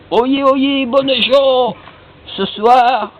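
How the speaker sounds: high, loud crier's call; town crier. Acted. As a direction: call out to the whole village. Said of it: "il prend son soufflet".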